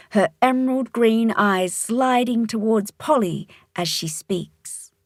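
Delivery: ordinary delivery, nothing marked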